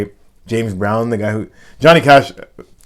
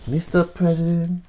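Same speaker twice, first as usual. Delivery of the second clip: slow, soft, higher-pitched impression; soft Marilyn voice; 7 semitones higher, 5 dB quieter, slower than usual. Host finishing his Marilyn birthday impression, soft voice. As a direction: coo it softly like a starlet, drawn out. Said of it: "with that, that soft voice".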